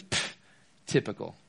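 soft scoffing pff, typical; dismissive scoff. Retold or imitated. A girl scoffing to her friend about Ben, quiet disdain. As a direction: brush him off with a quiet scoff. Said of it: "she goes".

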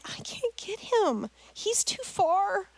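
high, slow, distressed plea; retold panic, high-pitched and drawn out; panicked mom. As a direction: act out helpless fear in a high voice. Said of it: "this is me at that moment".

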